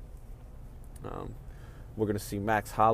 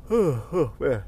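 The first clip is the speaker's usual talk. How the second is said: words stretched by a yawn; 4 semitones higher, 3 dB louder than usual. Real, not acted. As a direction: say it mid-yawn. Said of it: "I'm making myself yawn".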